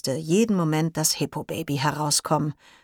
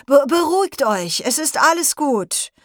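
higher, stammering, unsure reassurance; flustered teacher; 9 semitones higher, 4 dB louder than usual. Acted. Herr Pohl tries to calm the class, flustered and stammering. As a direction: try to calm them while rattled yourself. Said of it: "obwohl er selber ziemlich durcheinander wirkte".

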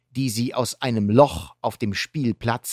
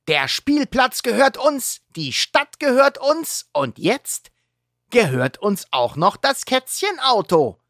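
announcing; 11 semitones higher, 3 dB louder than usual. Acted. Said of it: "verkündet Heuler".

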